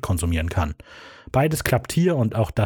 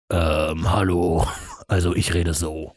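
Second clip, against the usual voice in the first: disguised deep voice; 5 semitones lower, slower than usual. Shown as a demonstration. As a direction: lower put-on voice. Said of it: "werde mit dieser Stimme reden".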